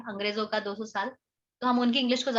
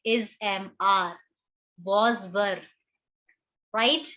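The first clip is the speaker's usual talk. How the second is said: ordinary talk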